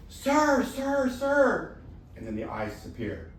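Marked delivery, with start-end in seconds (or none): 0.1-1.8 high, loud urgent calls; calling out. Acted. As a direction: grab the man's attention. Tiger calls to man above.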